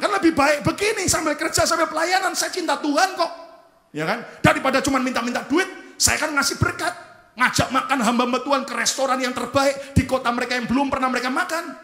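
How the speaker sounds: high, loud self-justifying argument; acted-out son pleading his case hotly; insistent protest. Son arguing back in retold quarrel.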